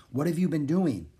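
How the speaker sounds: usual speaking voice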